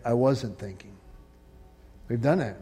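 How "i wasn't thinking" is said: regretful admission. Retold or imitated. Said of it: "Or, better yet".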